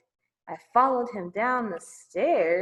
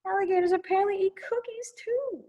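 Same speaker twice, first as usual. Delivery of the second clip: whispered, a bit higher; whispered aside; 9 semitones higher, 3 dB quieter, faster than usual. Real, not acted. Teacher whispering a joke to the kids during the story.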